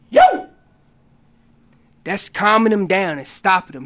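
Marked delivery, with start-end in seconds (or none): 0.1-0.5 a loud high yo call, cut short; sharp yo shout. Shown as a demonstration. Hunter shows the yo call, loud and sharp. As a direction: snap the yo out like an elk bark. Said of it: "Sounds like this".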